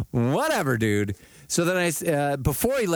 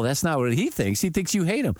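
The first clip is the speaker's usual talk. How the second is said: ordinary talk, nothing marked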